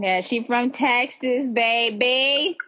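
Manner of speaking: playful, slow Texas twang; Texas accent. Acted. Co-host teasing her friend from Texas, Southern accent. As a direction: lean into the Texas accent, stretching it out. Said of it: "Southern accent".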